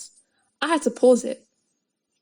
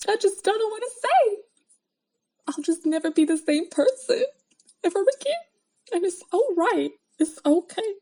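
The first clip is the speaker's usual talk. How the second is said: mock melodrama; 9 semitones higher than usual. Melodramatic mock despair over anime.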